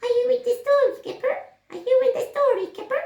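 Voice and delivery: very high, loud squeaky little mouse. Acted. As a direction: squeak it out like a tiny scared mouse. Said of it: "a little squeaky mouse came running".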